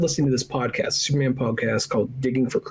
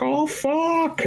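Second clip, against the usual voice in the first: sudden high yelp of mock alarm; hammed-up high yelp as [name] fakes knocking over pencils; fake clumsy yelp. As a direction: squeak it like a klutz caught out.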